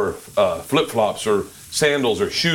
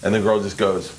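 normal talking voice

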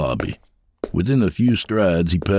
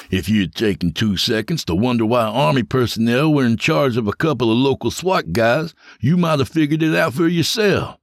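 higher, cocky voice savouring his power; smug James; 5 semitones higher than usual. Acted. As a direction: lord it over him, enjoying every word. James gloating over Harper.

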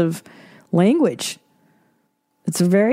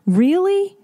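incredulous; 11 semitones higher than usual. Real, not acted. Incredulous retort.

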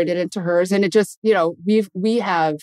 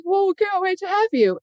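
gentle dad voice, pitched way up; imitating dad; 14 semitones higher than usual. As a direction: daughter imitating how her dad talks. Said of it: "And exactly how he talks".

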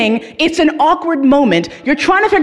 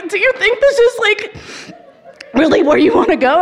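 much higher, wavering question through tears; in tears, asking the host about the gloomy record; teary pleading question; 8 semitones higher than usual. Retold or imitated. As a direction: ask it on the edge of crying. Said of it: "I'm like".

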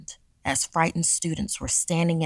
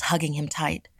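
usual speaking voice

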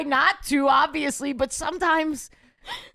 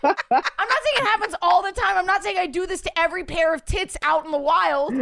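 ordinary delivery

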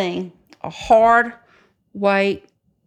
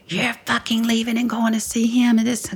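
raised pitch, imitating an angry husband; livid husband; 6 semitones higher than usual. Wife imitating husband as she leaves, livid. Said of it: "My husband got livid".

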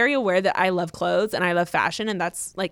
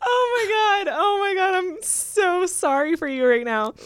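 high, slow, helplessly laughing pity; laughing sympathy; 9 semitones higher, slower than usual. Real, not acted. Host pitying the letter writer's drunken disaster, laughing. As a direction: wail your sympathy through laughter.

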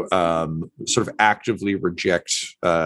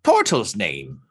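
slow, high creature voice; bird doctor; 3 semitones higher, slower than usual. Acted. Bird doctor introduces himself to Tortle, slow high voice. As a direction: introduce yourself slowly as an odd bird doctor.

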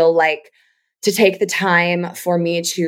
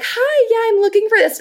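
much higher, eager phone greeting; high pleasing voice. Retold or imitated. Calling a new accountant the old way, in a high voice. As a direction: lift the voice up to please. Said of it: "usually I'd be like".

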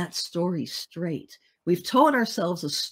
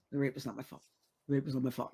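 low, quiet, rushed recital with no feeling; dispassionate; 5 semitones lower, 9 dB quieter, much faster than usual. Retold or imitated. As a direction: recite it detached, as if going through the motions. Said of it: "very dispassionate".

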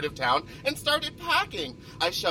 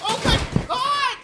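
very high, loud shriek; horrified shriek; 5 dB louder than usual. Acted. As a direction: shriek it in disbelief. Luggage being trashed.

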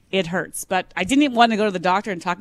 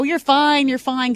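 brisk, higher "you're fine, you're fine"; soothing parent; 7 semitones higher, 4 dB louder, slower than usual. Retold or imitated. Mother to a child with a stubbed toe, brisk soothing. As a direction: brush off a kid's stubbed toe. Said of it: "how often you say to the kids".

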